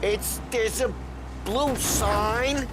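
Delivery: put-on high, slow drunken directions. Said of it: "you're drunk".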